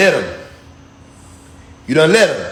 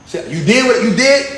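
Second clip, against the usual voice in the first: normal talking voice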